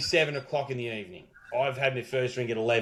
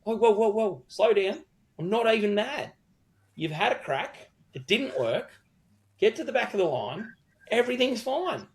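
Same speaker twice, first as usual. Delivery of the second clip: higher, breezy placating tone; mock calm; 7 semitones higher than usual. Punter to the queue-jumping girl, mock soothing. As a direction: brush her off lightly.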